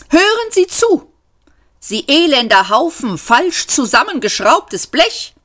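high, angry insect tirade; exasperated outburst. A narrator's reading. Insect clerk losing patience with the robot, exasperated. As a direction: burst out high and hurl the insult.

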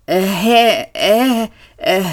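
flustered hesitant stammer; startled uh-uh-uh stutter. Acted. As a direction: stumble over the sounds in panic. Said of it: "stotterte er".

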